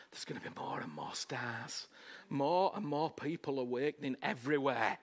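low, soft prophetic build; hushed preaching. Real, not acted. Preacher drops to a hush.

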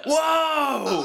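shrieked high amazed whoa. Real, not acted. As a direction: squeal of amazement. Amazed reaction to ad.

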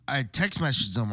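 ordinary delivery

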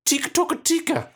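high, quick duck call; duck feed call. Shown as a demonstration. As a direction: chirp it like a duck caller. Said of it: "for a basic feeding call, say".

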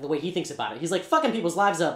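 ordinary talk

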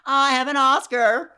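high, bright mock Anna Paquin; Anna Paquin impression. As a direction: squeak it out like a proud young winner. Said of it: "I can just do Anna Paquin".

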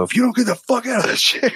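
high-pitched mock yell at his son; hammed-up angry shout at the kid. Dad yelling at his son to get out of the chair, high mock shout. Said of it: "And I go".

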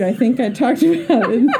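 giggly, amused insistence; laughing confession. Real, not acted. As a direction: admit it while cracking up. Teasing confession breaks into laughter.

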